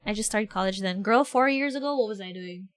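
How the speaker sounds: usual speaking voice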